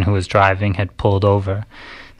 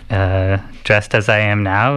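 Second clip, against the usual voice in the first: normal talking voice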